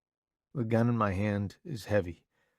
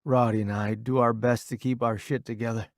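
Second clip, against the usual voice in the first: usual speaking voice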